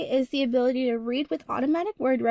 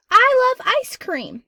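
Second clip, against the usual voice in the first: high, loud and slow with enthusiasm; excited; 11 semitones higher, 9 dB louder, slower than usual. Shown as a demonstration. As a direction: burst out with delight. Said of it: "you're saying it with excitement and enthusiasm".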